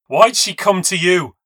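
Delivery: high, loud furious demand; furious shout. Acted. Rose screams at the narrator while shaking her, furious. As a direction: yell it in her face.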